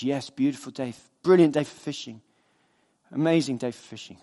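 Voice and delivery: low, lightly voiced easygoing fisherman; contented fisherman. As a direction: savour it lazily, a hint of the fisherman. Relaxed fisherman answers businessman.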